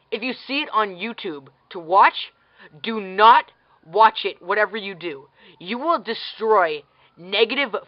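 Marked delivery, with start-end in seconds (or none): 2.8-4.4 slow, louder, every word weighed; emphatic warning. Real, not acted. Vlogger warning viewers off a bad movie, emphatic. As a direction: spell out the warning word by word, hitting not.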